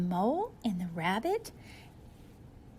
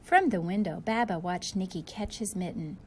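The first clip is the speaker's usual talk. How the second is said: usual speaking voice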